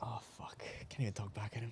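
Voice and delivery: hushed unvoiced whisper. Real, not acted. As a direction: breathe it out so nobody hears. Can't talk back, whispering.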